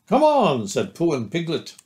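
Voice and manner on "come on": high, louder echoed call; shout. A narrator's reading. Pooh and Piglet echo the call to start, shouting. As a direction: pass the call along eagerly. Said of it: "There was a shout".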